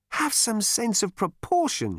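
high, slow nagging voice; nagging wife. Acted. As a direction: scold him like an exasperated wife.

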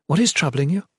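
mildly anxious inquiry; concerned. A narrator's reading. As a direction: ask with open concern. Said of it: "she said, concerned".

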